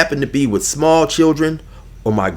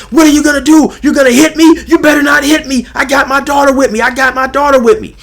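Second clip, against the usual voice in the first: much higher, louder panicked shouting; mock hollering; 12 semitones higher, 6 dB louder than usual. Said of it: "he started hollering again".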